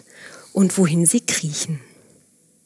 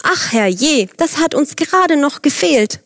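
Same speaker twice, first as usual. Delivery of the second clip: higher-pitched cry of dismay; dismayed outcry; 7 semitones higher than usual. A narrator's reading. Storyteller voices a worker finding trouble in the vineyard, dismayed outcry. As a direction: cry out in mild alarm, pitched up. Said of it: "Plötzlich ertönte ein Aufschrei".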